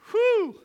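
a sudden high, loud whoosh of amazement; loud whew. Real, not acted. Preacher marvelling at the sinner's call, loud amazed whew. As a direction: blow out a big amazed whew.

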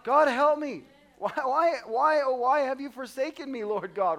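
panicked cry. Acted. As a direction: cry out as if the world is ending. Acting out panicked prayer.

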